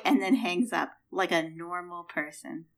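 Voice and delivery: normal talking voice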